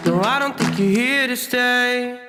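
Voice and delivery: ordinary talk, nothing marked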